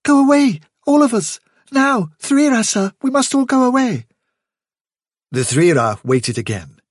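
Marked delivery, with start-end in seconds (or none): 0.0-4.1 very high, loud, alarmed little voice; urgent plea. A narrator's reading. Fiver urging the chief to flee, urgent. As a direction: plead urgently in a high-pitched voice. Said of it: "said Fiver instantly".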